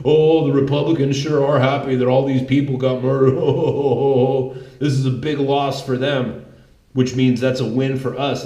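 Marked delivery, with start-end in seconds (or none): 0.0-6.4 mocking Cenk Uygur. Mocking a TV host gloating over the news, laughing. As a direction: sneer it through a mocking laugh. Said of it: "He gets on T V and says".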